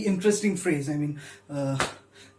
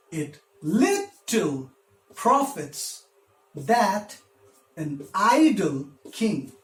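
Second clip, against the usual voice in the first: "little" hit on "lit", "profits" on "prof", "that" and "king" stressed; scanned iambic stress; much slower than usual. Shown as a demonstration. Teacher showing students the meter, stressing LIT, PROF, THAT, I of "idle", KING. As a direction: slowly beat out "It little profits that an idle king", leaning on every second syllable. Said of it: "the way our speech patterns work, this is how it breaks down".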